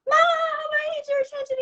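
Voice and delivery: high-pitched, loud tantrum yell; screaming child. Acted. Hurt child to busy mom at the playground, screaming. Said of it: "He's screaming, he's yelling at you".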